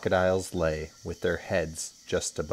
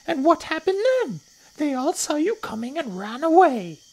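snorting little crocodile; 8 dB louder than usual. Acted. Not-so-big crocodile deflating a boast, high scornful voice. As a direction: scoff in a tiny high voice.

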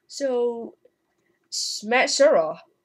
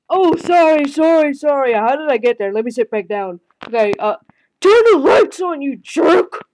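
higher, louder flustered shouts; high loud mock yell; loud pretend yelling; 5 semitones higher, 9 dB louder than usual. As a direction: yell it like a cross toy figure. Figures apologise then yell.

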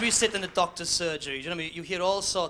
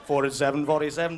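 lower, louder, brisk caller's call; bingo caller; 5 semitones lower, 4 dB louder than usual. Acted.

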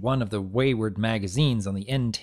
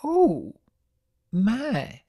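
put-on high, creaky elderly mare; an old granny fussing; lightly hammed-up old grandmother; 11 semitones higher than usual. A narrator's reading. Granny reacts.

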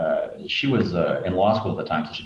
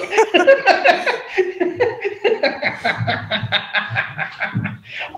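helpless giggling; high laughter; 5 dB louder than usual. Real, not acted. Caught telling her secret.

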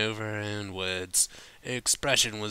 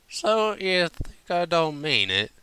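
higher, slow wounded southern voice; hurt drunk twang; 8 semitones higher, 5 dB louder than usual. Acted. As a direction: let it sting, slowly.

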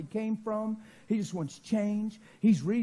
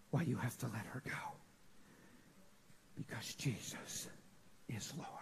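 hushed declaration; 8 semitones lower, 16 dB quieter than usual. Retold or imitated. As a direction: sink from low voice into a whisper. Retold hushed word to a girl.

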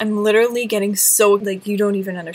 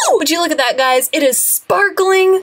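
thrilled cheer; 6 semitones higher than usual. Real, not acted. Vlogger showing viewers a sparkly beret, thrilled. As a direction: show off the prize with glee.

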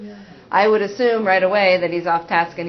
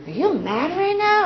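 gentle question; 11 semitones higher than usual. Shown as a demonstration. Asking angry preschooler gently.

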